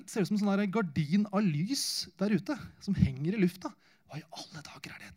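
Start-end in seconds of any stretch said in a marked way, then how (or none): 4.1-5.1 unvoiced, much softer whisper; whispered amazement at lights; wondering whisper. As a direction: breathe the question in wonder. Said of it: "tenker jeg".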